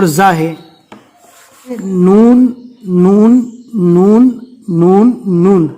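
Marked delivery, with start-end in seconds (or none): 1.8-5.7 نون said firmly with a held nasal; clear noon. Shown as a demonstration. Teacher drilling the class after the board, نون firm and held. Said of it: "یہ نون ہے".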